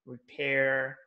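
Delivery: "repair" with a strong American R; American accent. Shown as a demonstration. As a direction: sound the final R firmly in American "repair". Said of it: "they use R in the strong sounds".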